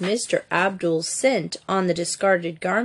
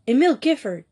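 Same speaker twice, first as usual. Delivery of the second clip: higher, startled cry of a name; 5 semitones higher, 3 dB louder, faster than usual. A narrator's reading. Louise unmasking the struggling figure, crying out. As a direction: cry out his name in recognition. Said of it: "she cried out".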